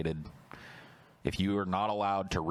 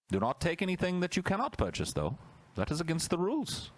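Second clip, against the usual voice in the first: high, prim decree voice; mock official voice; 10 semitones higher than usual. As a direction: read it like a smug bureaucrat.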